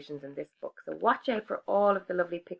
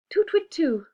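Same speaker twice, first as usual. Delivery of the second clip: higher, louder frantic hooting; panicked owl hoots; 11 semitones higher, 7 dB louder than usual. Acted. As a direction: hoot in mock alarm. Owl fleeing.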